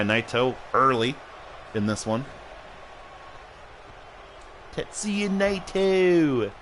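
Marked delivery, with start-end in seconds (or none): 4.7-6.5 high, slow, drawn-out name; announcer-style name call. Pretend. Streamer pestering Rich until he answers, theatrical call. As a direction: sing out each name, stretching the end.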